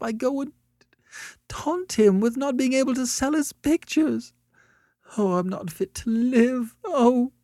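very high, tearful wailing; desperate sobbing. A narrator's reading. Annette confesses she taunted a struggling man, sobbing. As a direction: pour it out in a sobbing wail. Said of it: "sobbed desperately".

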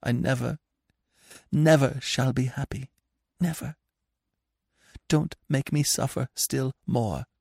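tender desperate parting; sad hushed farewell. A narrator's reading. Anna pleads softly.

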